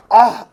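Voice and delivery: sudden sharp ouch; pained cry. Real, not acted. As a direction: yelp from a cut finger. Elini kesiyor. (acı ile ani çığlık)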